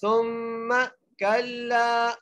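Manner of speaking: very slow chant, सुम्मा with a humming doubled meem; nasal ghunna. Shown as a demonstration. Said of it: "मीम पर गुन्ना करेंगे".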